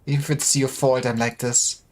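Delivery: usual speaking voice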